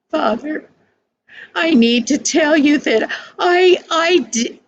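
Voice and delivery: put-on nervous boy trying to confess; boy's voice. A narrator's reading. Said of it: "his father interrupted him".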